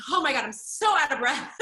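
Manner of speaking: high, loud line full of laughter; laughing, out of breath. Retold or imitated. Beatboxer to the interviewer, laughing and winded. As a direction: giggle it out like you've run out of air. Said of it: "I'm like".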